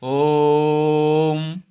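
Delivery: ओम with the ओ held very long and steady; chant-like prolonged ओम; drawn-out om. Shown as a demonstration. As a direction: hold the ओ in ओम three times as long. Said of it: "ओ को तीन गुना समय में बोला जाता है".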